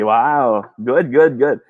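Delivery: ordinary talk, nothing marked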